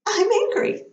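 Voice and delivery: high princess voice, mock-angry; Amy Adams. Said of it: "realizing she's super angry about things".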